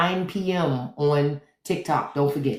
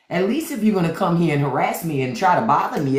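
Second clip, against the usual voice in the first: ordinary delivery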